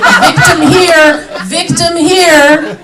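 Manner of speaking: slow, high mock yell. Comedian mock-yelling in Camden with purse open. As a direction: call out slowly like bait.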